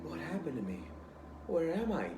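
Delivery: a lost, wide-eyed ghost asking; newly dead ghost, confused and lost; dazed ghost. Acted. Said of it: "walking around wide-eyed".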